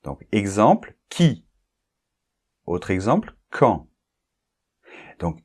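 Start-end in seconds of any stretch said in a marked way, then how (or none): none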